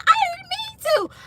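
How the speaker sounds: very high-pitched mock panic; imitated freak-out. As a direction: squeal it like a panicking coworker. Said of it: "She was freaking out on Friday".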